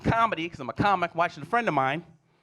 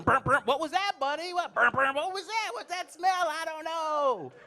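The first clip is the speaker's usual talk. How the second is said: higher, playfully fake-surprised; mock innocent dad; 11 semitones higher, slower than usual. Dad covering a fart for the kids, higher and mock innocent. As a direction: play dumb to fool the kids. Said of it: "To trick the children".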